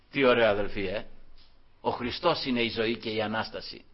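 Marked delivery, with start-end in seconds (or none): none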